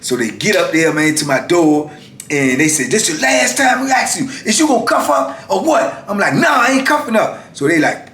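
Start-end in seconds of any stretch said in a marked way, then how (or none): 2.9-6.0 high, insistent imitation of officers; retold demanding call; officer's ultimatum. Officers give a last warning. 6.3-7.5 high-pitched retold defiance; acting out stubborn bravado; defiant refusal.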